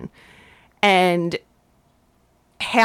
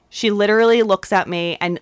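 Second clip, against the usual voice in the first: ordinary talk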